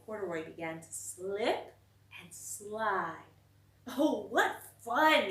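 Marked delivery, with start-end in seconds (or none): 3.9-5.3 slow, louder, higher delighted cry; grinning with glee; with a smile. A narrator's reading. Bear sliding on soap flakes, smiling delight. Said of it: "he said with a smile".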